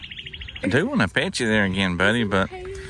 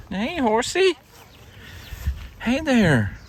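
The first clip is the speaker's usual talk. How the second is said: high sing-song voice for an animal; high pet-talk voice; slower than usual. Real, not acted. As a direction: coo at the horse up high. Talking sweetly to a horse.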